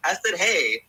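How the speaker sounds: normal talking voice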